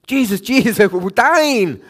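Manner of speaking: high, loud, hammed-up terror; mock panic. Disciples shouting at Jesus in the storm, hammed-up panic. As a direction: play the frantic disciple, half laughing.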